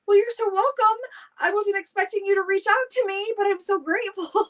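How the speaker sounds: grateful reply. Retold or imitated. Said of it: "And I was like".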